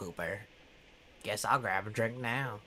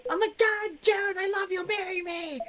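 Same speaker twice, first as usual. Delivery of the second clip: gushing starstruck fan. Retold or imitated. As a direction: gush like a lovestruck fan meeting her idol. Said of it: "something like".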